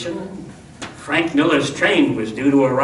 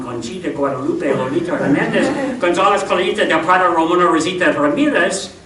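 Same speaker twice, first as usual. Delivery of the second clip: rattled-off name; faster than usual. Acted.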